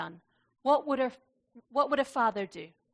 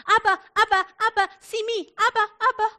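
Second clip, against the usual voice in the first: put-on high, loud child voice calling Abba; little kids calling; 10 semitones higher, 9 dB louder, faster than usual. Preacher voicing little kids calling their dad. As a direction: call out like a small child to daddy.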